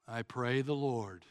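dull, rote mumbled grace; Eeyore. Acted. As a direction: recite it by rote, soft and lifeless. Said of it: "we sound like Eeyore of Winnie the Pooh".

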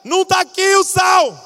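put-on high, loud teen shouting from the other room; yelling from kitchen. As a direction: call out triumphantly that it's not there. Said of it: "Aí ele grita na cozinha".

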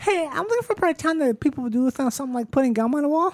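keen neighborhood seeker. Pretend. One comic poses as someone seeking a part of town, mock-eager. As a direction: pitch it up like a mock-eager newcomer.

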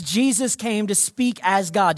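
usual speaking voice

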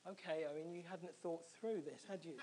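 quiet talking to himself; softly voiced self-reproach; inner thought. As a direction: say it low in volume, as a private thought. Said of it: "thinking to myself".

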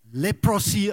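leprosy as first misread, middle syllable fully sounded; wrong pronunciation. Shown as a demonstration. Preacher admitting a word looked up online, mispronounced leprosy. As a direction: say leprosy with a full, clear O in the middle. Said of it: "I realized it's not leprosy".

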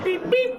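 very high electronic-style beeps; imitated beeping. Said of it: "the sticker starts beeping".

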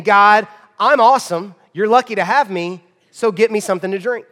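loud, entitled mock demand; temper tantrum. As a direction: imitate an arrogant man throwing a tantrum. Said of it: "throwing a temper tantrum to God".

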